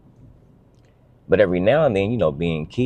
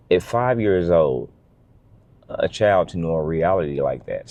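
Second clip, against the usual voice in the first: ordinary delivery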